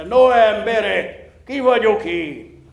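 slow, loud swaggering question; boastful challenge. A narrator's reading. Blacksmith boasting to the gawking crowd, slow and swaggering. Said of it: "döjfösen kérdezte".

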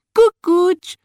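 very high little dwarf voice; playful peekaboo. A narrator's reading. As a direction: squeak it out high, playing hide-and-seek. (magas, játékos törpehang)

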